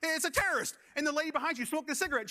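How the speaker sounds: normal talking voice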